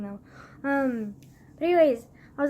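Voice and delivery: normal talking voice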